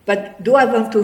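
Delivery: ordinary delivery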